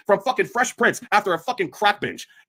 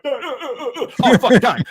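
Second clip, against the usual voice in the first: mock moaning; 4 semitones higher, 5 dB louder than usual. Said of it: "getting pumped like".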